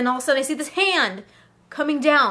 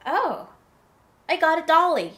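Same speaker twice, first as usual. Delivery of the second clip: put-on delighted little boy; 3 semitones higher than usual. Boy finds a doll.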